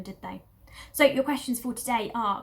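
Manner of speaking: usual speaking voice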